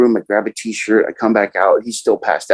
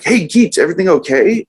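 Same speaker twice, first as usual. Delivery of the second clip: buddies calling over; 8 semitones higher than usual. Retold or imitated. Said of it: "they're like".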